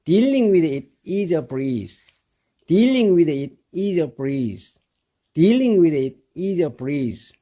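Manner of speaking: very slow, each word laid out for learners; slow model reading. Shown as a demonstration. Teacher to students, very slow model reading. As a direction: read it out slowly for students to copy. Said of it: "이렇게 되는 겁니다".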